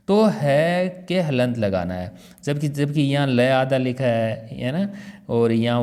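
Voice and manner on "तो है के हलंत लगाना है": ordinary talk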